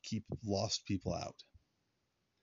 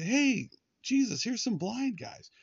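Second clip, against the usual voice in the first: much higher, slightly louder, slow eager call; eager crowd calling out; 13 semitones higher, 3 dB louder than usual. Pretend. Crowd flagging down Jesus for the blind men, eager high call. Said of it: "The multitude doesn't, like, say".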